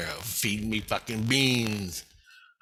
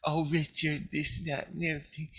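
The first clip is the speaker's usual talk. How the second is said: higher, softer sing-song mockery; mocking whiny voice; 4 semitones higher, 4 dB quieter than usual. Mocking the bureaucrat lecturing Richard about community, high and whiny. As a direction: parody their preachy talk of community. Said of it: "Don't sit there and say".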